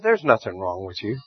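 calm him down gently; reassuring God. Retold or imitated. God reassuring the worried preacher, soft and low. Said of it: "He said".

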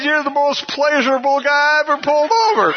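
high, delighted voice breaking into laughter; laughing cop. Retold or imitated. Officer to the honest speeder, cracking up with amusement. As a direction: imitate an officer tickled by the driver. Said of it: "he's laughing".

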